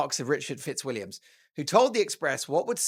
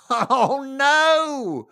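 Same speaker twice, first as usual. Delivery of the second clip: mock horror; 9 semitones higher, 7 dB louder than usual. Mock horror at his own confession.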